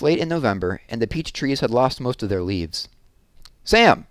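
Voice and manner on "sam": high, loud call of a name. A narrator's reading. Someone hailing the onion man, calling out. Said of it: "someone called".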